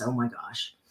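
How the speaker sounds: ordinary talk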